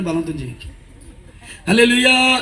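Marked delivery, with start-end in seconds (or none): 1.7-2.4 loud, high call; shouted hallelujah. Real, not acted. Preacher demanding a louder hallelujah, shout. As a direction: rouse them with it.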